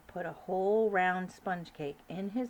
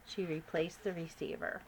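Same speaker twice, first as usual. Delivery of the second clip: plain reading voice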